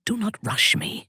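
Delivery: ordinary talk, nothing marked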